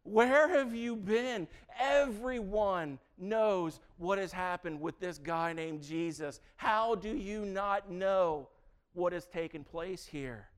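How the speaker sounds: loud, high, disbelieving bro voice; a hammed-up, loud, exasperated friend; mock incredulous. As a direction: scoff at the stranger who knows nothing. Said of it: "He's like".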